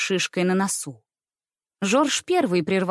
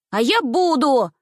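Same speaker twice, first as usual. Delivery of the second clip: put-on high, drawn-out little boy's squeak; defiant squeak; 5 semitones higher, 4 dB louder than usual. A narrator's reading. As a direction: pipe up defiantly. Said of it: "неожиданно пропищал мой милый Пятница". (тоненько, с вызовом пропищать)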